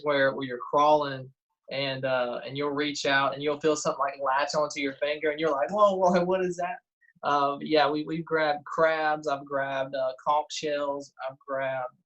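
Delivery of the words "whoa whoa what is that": higher, startled exclamation with a laugh; mock alarm, pitched up, breaking into laughter; retold startle. Diver reliving something latching onto his finger, startled.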